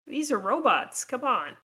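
usual speaking voice

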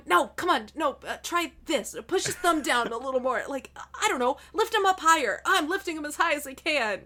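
bickering pair, high and hurried, half laughing; acted frustration. Two partners struggling with a limp hand, mock frustration. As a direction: act out a fumbling, frustrated back-and-forth. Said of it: "where they get frustrated enough".

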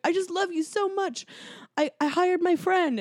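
high-pitched lovesick excuse; mock pleading confession. Host voices schemer confessing love to woman, mock pleading. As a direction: imitate a desperate confession, pitched well up.

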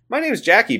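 high put-on character voice; Jackie Tyler. Jackie introducing herself to Elton, high imitated voice. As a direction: pipe up high, butting in as a chatty stranger. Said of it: "Jackie just walks up and says".

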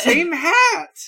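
high, loud laughing "same hat"; shouting with a laugh; excited laughing shout. Real, not acted. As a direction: burst out in gleeful agreement.

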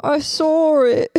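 slow, high mock-British accent; odd mock-British tone. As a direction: drag it out in a silly fake British accent. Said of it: "Why do you speak in that tone?".

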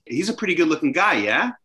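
statement tipped up into a question at the end, yeah? rising; British tag question. Retold or imitated. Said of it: "they'll actually turn it into a question".

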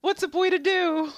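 hammed-up vain boast. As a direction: strut it like a show-off. Retelling a preening character's line.

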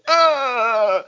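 sudden high yelp of shock; short pained actor's gasp-cry; anguished cry. Retold or imitated. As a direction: cry out like you just killed someone. Actor's shocked reaction after the kill.

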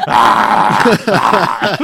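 harsh wordless yell; exaggerated yelling for a joke; mock yell. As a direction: let out a comic "ah" scream. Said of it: "I'm just gonna be like".

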